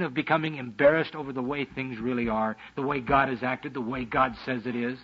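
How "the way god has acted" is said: ordinary delivery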